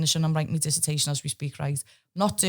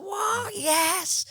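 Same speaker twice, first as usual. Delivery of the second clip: squeaky high what, then yes; stunned then thrilled. Retold or imitated. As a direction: act gobsmacked then overjoyed. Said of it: "It's like that".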